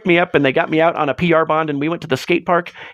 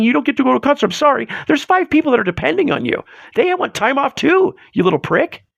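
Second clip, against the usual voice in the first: heated rant; 8 semitones higher than usual. Real, not acted. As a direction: vent hard at lazy employees. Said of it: "I get very emotional about this stuff".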